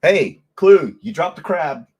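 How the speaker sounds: high, loud mocking call-out; mock crude woman. Host imitating what she would say, mocking call-out. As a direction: call it out high, teasing her. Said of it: "that's something she would say".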